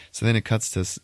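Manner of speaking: ordinary delivery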